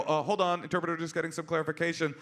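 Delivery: flustered stalling. Retold or imitated.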